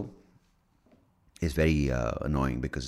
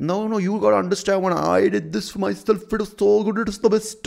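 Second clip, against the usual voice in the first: high, loud, overexcited insistence; mock pushy advice-giver; 13 semitones higher, 5 dB louder than usual. Pushing advice on someone unwilling, mock over-eager insistence. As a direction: gush it out high and loud, cutting in.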